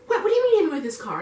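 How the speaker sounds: high, fast, baffled question; imitated incredulous friend. Friend Jake on the phone hearing the news, incredulous.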